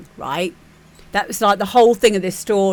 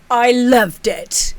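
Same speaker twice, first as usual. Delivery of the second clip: judge's twist reveal; 4 semitones higher, 4 dB louder, slower than usual. Retold or imitated. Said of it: "he goes".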